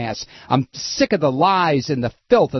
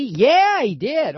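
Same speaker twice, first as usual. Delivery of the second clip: mock eager chatter; 10 semitones higher, much slower than usual. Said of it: "talking about".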